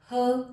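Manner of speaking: her said as a lone, punched 'huh'; isolated h sound. Shown as a demonstration. Teacher giving learners the letter's sound, isolated h. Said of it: "और ह इसका sound है".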